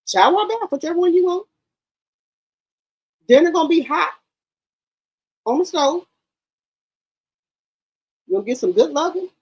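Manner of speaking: sugary high voice, eager to please; a pretend doting wife, pitched high and sweet; doting housewife. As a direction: sweet-talk him like a wife who serves everything. Acts out a doting, catering wife.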